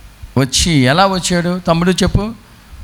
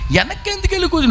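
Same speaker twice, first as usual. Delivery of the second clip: much higher and softer, astonished; 13 semitones higher, 5 dB quieter, much faster than usual. Acted. Pastor astonished the believer sat at the back, high and soft. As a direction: let the question leap up in disbelief.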